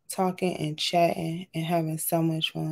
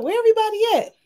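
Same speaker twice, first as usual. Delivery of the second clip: surprised; 13 semitones higher, 5 dB louder, much faster than usual. Retold or imitated. Retold calling out for viewers.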